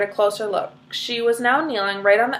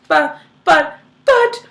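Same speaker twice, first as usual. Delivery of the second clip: high, loud stammered shriek; 6 semitones higher, 7 dB louder, slower than usual. Acted. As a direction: sputter in panic. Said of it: "shrieked Missus Salt".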